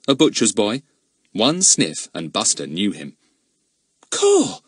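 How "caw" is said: startled exclamation. A narrator's reading. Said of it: "said Ern".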